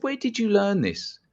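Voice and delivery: high-pitched, incredulous demand; retold fuming outrage. Hypnotist to caller trained on videos, retold outraged disbelief. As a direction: retell it as an outraged challenge. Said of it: "I was absolutely fuming".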